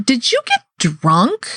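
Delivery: imitated mom asking, rising and drawn out; mother's questioning. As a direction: draw it out like a suspicious mother. Retold mother questioning her on phone.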